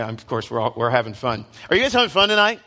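normal talking voice

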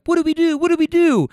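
high, loud frantic repeated question; mock panic. Panicking friends ask the server what to do, frantic high. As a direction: play the flustered friends losing it.

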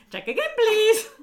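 piercing, very loud mimicked squeal; comic sugar-high yell, far above normal pitch; high-pitched shouting. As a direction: parrot it back in a hyper shriek. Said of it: "sort of high-pitched sort of shouting".

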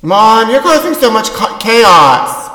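high, loud complaining daughter; whiny daughter. Acted. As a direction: whine at your mother. Daughter voice in sketch.

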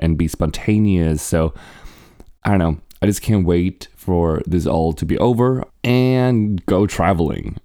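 ordinary talk

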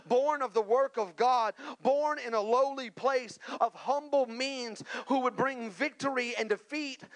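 high, driving sermon climax; full-force preaching. Real, not acted. Preacher announcing the Messiah who will be king, high and forceful. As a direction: proclaim it with mounting force, punching each phrase.

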